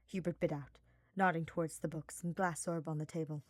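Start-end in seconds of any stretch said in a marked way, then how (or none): none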